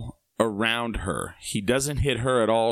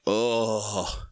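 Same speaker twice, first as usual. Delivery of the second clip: drawn-out groan of mild revulsion; retold disgust. Partygoer seeing bloody hair on the floor, retold disgust. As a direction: let out a long, grossed-out "oh". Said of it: "from how gross it was".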